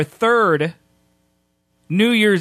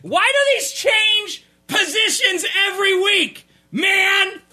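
exasperated shout; 13 semitones higher, slower than usual. Real, not acted. Frustrated outburst at the mixer.

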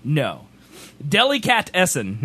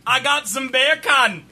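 higher-pitched mock Jamaican; Jamaican accent; 5 semitones higher than usual. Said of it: "you could say bacon in Jamaican".

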